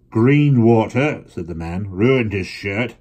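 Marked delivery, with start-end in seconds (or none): 0.1-1.2 slow, loud deliberate complaint; angry father grumbling; heavy slow stranger. Acted. 1.9-2.9 slow, loud deliberate complaint; angry father grumbling; heavy slow stranger. Acted.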